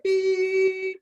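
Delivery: high, sustained beep; one long, high-pitched beep held steady; imitated voicemail beep. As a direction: mimic an answering machine tone.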